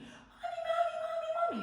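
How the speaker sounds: very high, loud wail for mother; acted-out piercing cry for help; child's scream. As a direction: shriek like a tattling little brother. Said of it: "I hear him scream".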